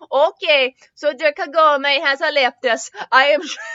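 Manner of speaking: put-on a playful Swedish accent for the monk. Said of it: "Do you like my Swedish accent".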